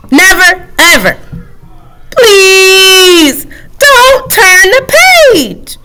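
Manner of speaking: the book character begging; desperate book plea. Acted. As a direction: beg the reader.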